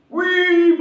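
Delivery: high, drawn-out "wih"; mock awe. Preacher mimics people dazzled by possessions, mock awe. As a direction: squeal it in exaggerated admiration.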